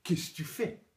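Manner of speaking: lightly voiced chatty French friends; casual friends' questions. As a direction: imitate friends tossing out short questions. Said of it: "Mes amis me disent".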